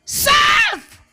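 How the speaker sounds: very high, very loud cry; full-throated, sustained preacher's climax; climactic shout. Acted. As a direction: shout the resolve to the rafters. Prodigal's climactic resolve.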